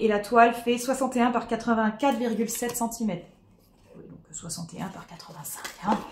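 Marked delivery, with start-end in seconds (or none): 4.0-5.6 correction chuchotée à part; chuchoté. Real, not acted. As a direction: murmurer la correction. Correction à voix basse. (en chuchotant)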